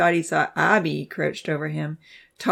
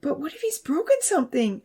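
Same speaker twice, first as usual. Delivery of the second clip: pleading; 10 semitones higher than usual. A narrator's reading. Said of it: "Abby pleaded".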